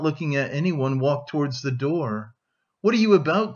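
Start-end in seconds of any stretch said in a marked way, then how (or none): 2.8-3.5 raised, higher-pitched startled challenge; crying out. A narrator's reading. Friend catching him at the door, crying out. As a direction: cry out, mildly, to stop him. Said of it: "cried Razumihin".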